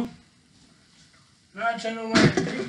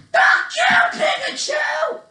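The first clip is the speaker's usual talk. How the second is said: play-fight rage, pitched way up; mock furious yell. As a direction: shriek it at a wrestling opponent. Acted fight with Pikachu.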